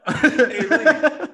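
slow, loud laughing call; drawn-out call. Real, not acted. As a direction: stretch it out, calling across the room. Calling out to Rudy teasingly.

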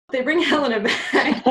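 "They bring Helena back" through giggles; laughing. Real, not acted. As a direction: say it while cracking up. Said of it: "God, this play is complicated".

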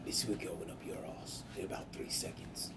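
low whispered British menace; whispered tough-guy warning; whispered Statham threat. Acted. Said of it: "Sounds like Jason Statham".

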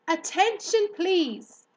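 high, loud announcement; calling out. A narrator's reading. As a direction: call for attention across the barn. Pig calls out to barn.

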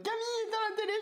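high, sharp mum voice calling; a mother ordering the TV off; scolding mother. Retold or imitated. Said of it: "t'es en mode".

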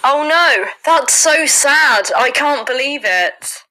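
mock sorrow, slow and loud; mock sad. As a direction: play the tragedy way over the top. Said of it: "voice note that I labelled as the word sad".

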